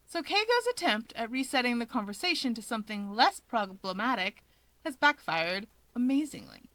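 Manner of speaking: ordinary delivery, nothing marked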